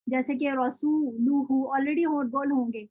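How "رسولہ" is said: رسولہ recited slowly with rounded lips on the ending; slow careful recitation of رسولہ; Quranic recitation. Shown as a demonstration. Teacher recites an example for the students, slowly. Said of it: "یا اس کے ماقبل پیش ہو".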